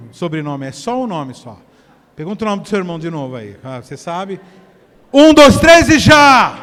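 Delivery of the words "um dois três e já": slow, much louder shouted countdown; rally the room with a big slow count. Real, not acted. Pastor counts the congregation in to call out.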